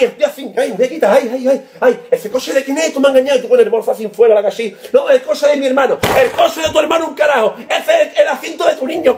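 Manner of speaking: usual speaking voice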